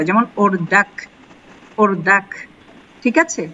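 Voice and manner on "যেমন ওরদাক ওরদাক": ওরদাক, its last sound midway between k and h; low, careful ওরদাক, ending between kaf and he; Iranian-style final consonant. Shown as a demonstration. Teacher showing students how Iranians say it, careful pronunciation. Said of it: "কাফ and হে একটু এটার মাঝামাঝি উচ্চারণটা".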